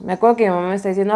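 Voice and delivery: ordinary delivery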